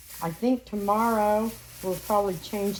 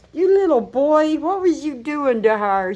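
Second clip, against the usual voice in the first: even higher, teasing pet voice; talking to a puppy; 6 semitones higher, 4 dB louder than usual. Real, not acted. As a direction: push the teasing further. Repeated cooing.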